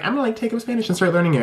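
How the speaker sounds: high, fast imitation of yourself; mock eager resolve. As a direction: rattle off a big plan with mock enthusiasm.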